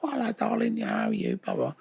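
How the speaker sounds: breezy showbiz greeting, lightly voiced; hint of a theatrical old cockney dame; Dot Cotton voice. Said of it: "exactly like she's in the show".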